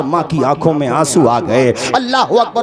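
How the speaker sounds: ordinary delivery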